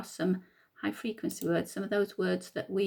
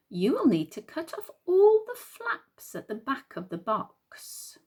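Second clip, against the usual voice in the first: lilting, deliberate read-aloud with higher swoops; reading to children; 4 semitones higher than usual. Acted. Teacher reading what you will need, storytime read-aloud.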